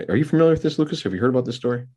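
mock-innocent question, pitched high; sarcastic. Real, not acted. Host asks co-host about a world-famous story, sarcastic. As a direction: ask with put-on innocence, voice lifted. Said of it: "I'm being sarcastic, folks".

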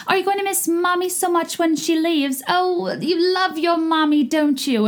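put-on very high, over-sweet baby talk; mock mommy voice. Podcaster mocking Bravo moms' performative mothering, syrupy mommy voice. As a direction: coo it like a hammed-up doting mom. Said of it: "people don't talk like that".

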